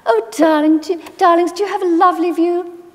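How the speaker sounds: put-on high, sweet, girlish voice hiding a barb; sugary put-down from a posh old lady; girlish posh grandmother. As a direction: voice it high and sweet while being cruel. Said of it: "She'd go".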